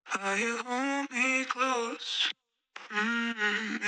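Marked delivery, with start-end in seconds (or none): none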